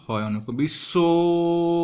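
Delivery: বিশ্বভূ with its final vowel drawn out long and slow. Shown as a demonstration. Teacher showing the long vowel in বিশ্বভূ, slow stretch. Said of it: "টানটা".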